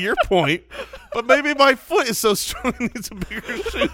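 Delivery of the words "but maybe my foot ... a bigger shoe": amused joke. Acted. Joking about a buff foot.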